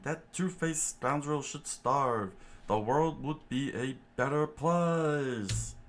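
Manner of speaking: high put-on chef voice, steady telling; exposition chef. Chef tells the story of Krieg, high character voice.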